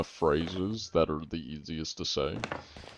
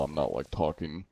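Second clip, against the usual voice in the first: ordinary delivery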